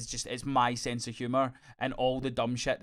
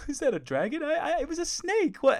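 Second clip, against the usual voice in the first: higher, giggling disbelief; imitating laughing; 14 semitones higher, 4 dB louder than usual. A fan imitating Vigilante laughing at tiny changes. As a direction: mimic someone cracking up in amazement. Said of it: "And he's proper laughing".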